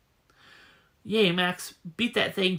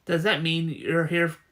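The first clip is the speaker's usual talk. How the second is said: ordinary talk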